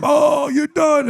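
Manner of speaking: high mock yell from the game room; retold scream. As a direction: belt it out like the kids shouting at the screen. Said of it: "they're screaming".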